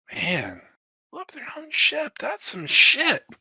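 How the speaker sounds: whispered, awed mutter; hushed aside. Acted. Crewman mutters to his mate after the boss scolds them, whispered awe. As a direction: whisper it under your breath.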